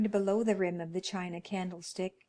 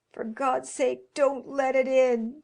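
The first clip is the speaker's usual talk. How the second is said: trembling cry; 5 semitones higher, 8 dB louder than usual. Acted. Old man begging his wife not to open the door, terrified. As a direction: beg her, shaking with dread. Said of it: "cried the old man, trembling".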